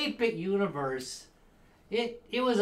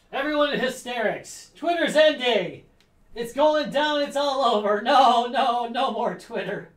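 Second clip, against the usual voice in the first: higher, louder fake alarm; mock panic; 8 semitones higher, 8 dB louder than usual. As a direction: play it like the world ends. Mock panic over Twitter ending.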